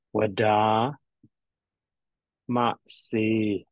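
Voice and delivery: ordinary talk, nothing marked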